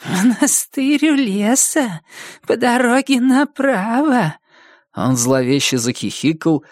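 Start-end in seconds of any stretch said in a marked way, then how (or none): 0.0-4.4 very high, slow eerie little voice; high-pitched newborn ghost; whispering ghost baby. Acted. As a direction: speak as a tiny phantom, pitched way up and drawn out. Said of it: "шептавшего мне по-польски из постели".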